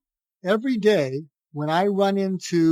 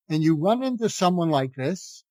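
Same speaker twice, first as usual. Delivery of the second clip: normal talking voice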